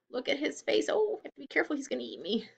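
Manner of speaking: ordinary talk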